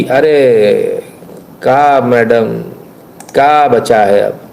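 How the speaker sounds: low, slow, drawn-out taunt; mocking. Host taunts the madam in the reel, mocking. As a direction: tease her in a long low drawl.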